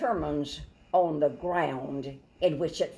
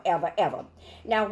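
ordinary talk

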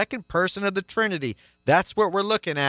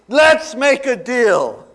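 "Let's Make a Deal" said slowly, louder, breaking into laughter; laughing; 8 semitones higher, 8 dB louder, slower than usual. Real, not acted. Pastor recalling an old game show to the congregation, laughing. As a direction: laugh as the show's name comes back.

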